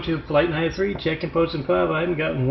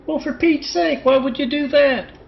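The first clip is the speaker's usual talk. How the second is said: high-pitched acted exasperation; exasperated disbelief; 10 semitones higher than usual. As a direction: scold the caller in high, incredulous dismay. Mock phone call with jumper.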